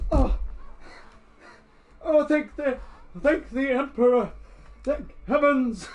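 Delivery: high, loud gasping thanks; ecstatic. Acted. Old man kneels kissing his rescuer's feet, ecstatic thanks. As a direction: praise heaven in overwhelmed relief. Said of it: "is ecstatic".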